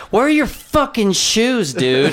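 high, drawn-out mock outrage; mock heckling. Comic retells heckling a bombing act on stage, mock heckling. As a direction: heckle with slow, high-pitched disbelief. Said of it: "I just start heckling him".

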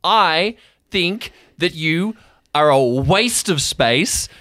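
loud, high, frustrated rant; angry outburst. Acted. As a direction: tear into him for overprotecting. Sky's angry outburst at Jeremy.

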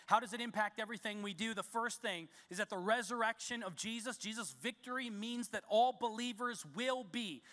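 ordinary talk, nothing marked

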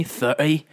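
"Thirty" with the middle t dropped; British glottal stop in "Thirty"; Cockney "thirty". Acted. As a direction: say "Thirty" as Cockney thir'y, rising. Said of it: "Can you say thirty for me?".

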